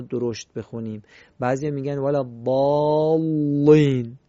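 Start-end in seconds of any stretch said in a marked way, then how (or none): 2.0-4.2 slow, loud ولا ضالین with the lam of ضالین made thick; overdone heavy lam. Shown as a demonstration. Teacher mocking the affected reading, loud and heavy. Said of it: "این غلطه، این تکلفه".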